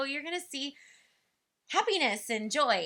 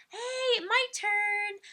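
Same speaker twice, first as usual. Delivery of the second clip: much higher, louder call to jump in; eager to join; 11 semitones higher, 4 dB louder, much slower than usual. Shown as a demonstration. Parent jumping into the tablet song, high and loud. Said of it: "mouthing the words to mommy finger".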